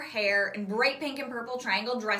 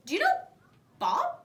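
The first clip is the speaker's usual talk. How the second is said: put-on eager girl; 4 dB louder than usual. A narrator's reading. Livy asking hopefully, voiced higher.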